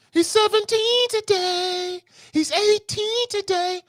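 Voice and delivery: high, slow sing-song parent voice; mock proud parent. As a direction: chant it like a gushing parent, way up high.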